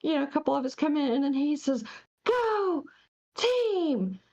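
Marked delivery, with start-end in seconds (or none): 2.2-4.2 imitating a manager's high, drawn-out call; mock rallying yell, pitched up but not really loud; retold loud cheer. Truck stop worker imitating the manager rallying the team, mock yell. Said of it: "just as loud as he can".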